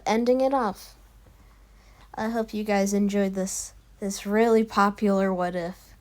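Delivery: usual speaking voice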